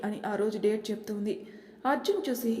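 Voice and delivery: ordinary delivery, nothing marked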